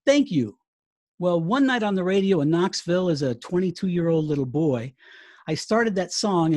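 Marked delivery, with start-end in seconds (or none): 0.1-0.6 lightly imitated singer thanking a crowd; mock stage thank-you, a bit louder. Retelling a singer answering applause, mildly called-out imitation. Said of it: "then you hear [name] say".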